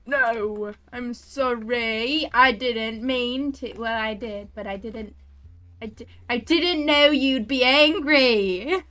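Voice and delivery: loud, drawn-out pleading; wailing apology. Real, not acted. Player apologising to the angry creature, loud drawn-out wail. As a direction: wail it slowly, begging for mercy.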